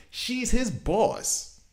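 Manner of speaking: high, slow and drawn out in disbelief; scoffing disbelief; incredulous mock. Real, not acted. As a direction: stretch it out like it's absurd. Mocking the ring's rule.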